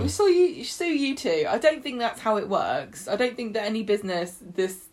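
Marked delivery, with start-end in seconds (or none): 0.0-1.5 high, singsong mock threat; cheery threat. Presenter echoing the recruiter's suing threat, mock-cheery. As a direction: echo the threat in a chirpy high voice. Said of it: "how cheerily he told him".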